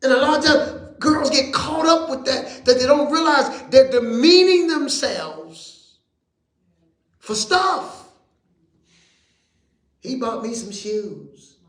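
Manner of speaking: high, loud preacher's call; full-force preaching. Acted. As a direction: preach it up to the rafters.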